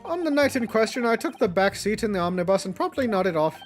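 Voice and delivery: lightly voiced Irish old man; Irish accent. Voices Irish witness testimony.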